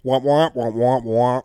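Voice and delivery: Charlie Brown teacher trombone voice; muffled wah wah talk. Retold or imitated. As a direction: womp it out like a muted horn. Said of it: "What is he, Charlie Brown's teacher?".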